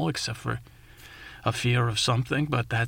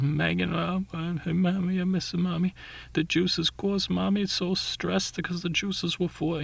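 put-on higher child's voice, upset and rambling; lightly voiced little girl after a fall; 7 semitones higher than usual. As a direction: play a flustered young girl. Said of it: "And, uh, she says".

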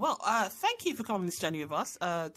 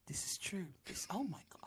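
whisper; 3 semitones higher, 9 dB quieter than usual. Real, not acted. Whispered aside.

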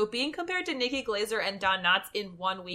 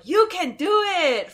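high, loud, slow encouraging yell; Rob Schneider cheer; 10 semitones higher, 8 dB louder, slower than usual. Retold or imitated. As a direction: cheer him on from the crowd. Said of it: "Rob Schneider's like".

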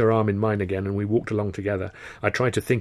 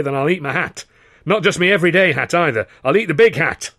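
loud, higher, mocking old impresario; boisterous theatre boss; 6 semitones higher, 6 dB louder than usual. Karno scoffing at Weldon to the boys, loud and high. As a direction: roar it like a gleeful manager.

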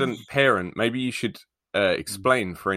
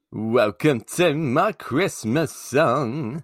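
slow, stretched out singer voice; celebrity impression; 5 semitones higher, much slower than usual. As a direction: croon it like a famous singer. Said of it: "quote a Christmas song or film in the voice of a famous person".

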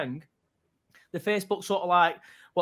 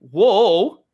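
mock whoa; 2 semitones higher, 10 dB louder than usual. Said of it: "he originally said".

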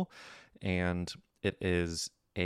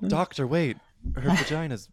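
mock panicked patient; 9 semitones higher, 5 dB louder than usual. Mock doctor scene, laughing.

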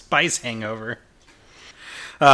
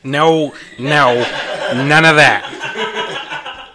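loud refusal; 4 semitones higher, 5 dB louder, much slower than usual. Acted. Refusing more hot sauce.

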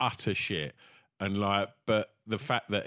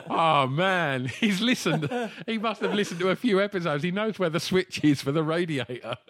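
raised-pitch retold amazement; higher, marvelling retelling of a realisation; amazed reaction; 6 semitones higher than usual. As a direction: act out being pleasantly astonished.

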